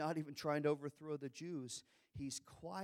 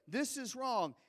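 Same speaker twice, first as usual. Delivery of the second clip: firm objection; 9 semitones higher, 5 dB louder than usual. Pretend. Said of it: "Instead of saying".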